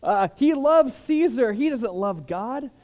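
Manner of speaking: hammed-up denouncer rousing the crowd; mock outrage. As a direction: whip up the crowd against him. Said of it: "they're gonna be like".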